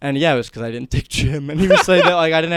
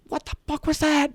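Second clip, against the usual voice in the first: high-pitched mock yell of outrage; incredulous retold screech; mock screaming; 14 semitones higher than usual. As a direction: imitate an instructor screaming, pitched way up. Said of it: "like, screaming at me".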